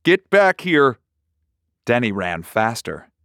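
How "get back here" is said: loud, high shout; father hollers at his son to come back; hollering. A narrator's reading. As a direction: yell it after him, fed up. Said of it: "Junior hollered".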